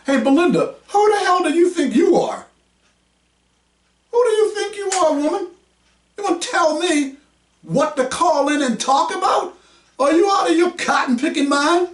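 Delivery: angry outburst. Pretend. Host yelling at caller Belinda, pretend furious shout. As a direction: blow up at her, then catch yourself. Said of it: "Now, I can't talk like that".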